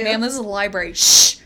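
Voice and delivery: stern library hush, loud shh; librarian shushing whisper. Pretend. Mock librarian scene.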